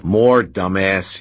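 slow, loud angry yell. Acted. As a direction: yell it at him, drawn out. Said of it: "he yelled".

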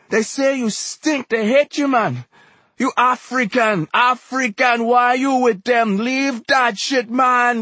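slow, high, loud accusing cries; anguished harangue. A narrator's reading. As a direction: drive every accusation home at full force. Exhorter's tearful harangue over fallen rival.

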